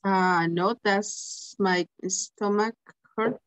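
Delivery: usual speaking voice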